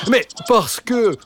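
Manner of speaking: high, slow dog voice; talking dog. Acted. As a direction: stretch it out like a patient dog. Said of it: "répondit Jean Waf".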